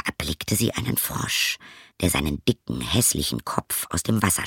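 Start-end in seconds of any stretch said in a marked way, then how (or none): none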